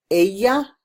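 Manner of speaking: Ella said eh-ya, the double L as a Y; Ella with a Y sound. Shown as a demonstration. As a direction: give Ella a clear Y sound in the middle. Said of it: "two L's are a Y sound".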